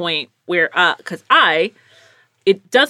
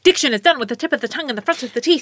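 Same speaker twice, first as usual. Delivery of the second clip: rapid, crisp tongue twister; very fast, higher-pitched diction exercise; theater diction drill; 4 semitones higher, much faster than usual. Shown as a demonstration. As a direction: rattle it off like an actor's warm-up. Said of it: "It's that theater thing".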